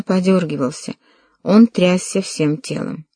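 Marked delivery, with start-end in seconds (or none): none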